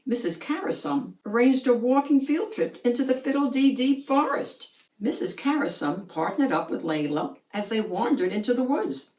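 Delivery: plain reading voice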